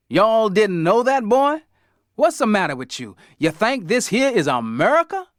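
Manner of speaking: put-on Dad lampooning a Southern cracker in a hillbilly accent. Dad teases the kids in a mock hillbilly accent. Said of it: "say some more Southern-style stuff".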